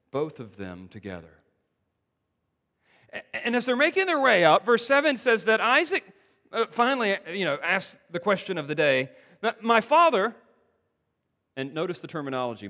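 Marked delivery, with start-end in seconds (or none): 3.1-10.3 loud, raised preaching climb; full-force preaching. Acted. Preacher retelling Isaac's climb to the congregation, loud and raised. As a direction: build the story with rising urgency.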